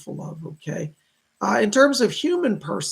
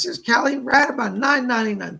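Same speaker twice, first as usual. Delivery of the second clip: higher, louder donor shout-out; excited thanks-giving host; delighted announcement; 5 semitones higher, 6 dB louder than usual. Real, not acted. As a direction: announce the gift with delighted surprise.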